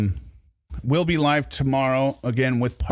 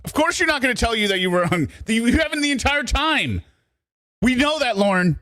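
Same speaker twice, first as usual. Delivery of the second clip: mocking disbelief; 11 semitones higher than usual. Real, not acted. Mocking the played clip's grammar.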